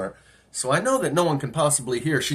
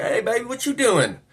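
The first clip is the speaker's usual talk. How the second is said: higher-pitched come-on; 8 semitones higher than usual. Retold or imitated. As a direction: imitate a girl hitting on a stranger.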